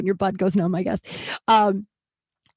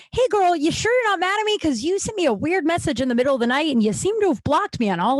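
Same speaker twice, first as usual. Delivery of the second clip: mock-sweet, much higher "hey girl"; sugar-coat it, pitched way up; overly sweet; 8 semitones higher than usual. Texting the friend who blocked her, mock-sweet and high.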